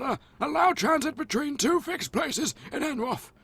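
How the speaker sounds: put-on high, wavering old man. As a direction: play a doddering old sage. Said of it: "my stereotypical old man voice".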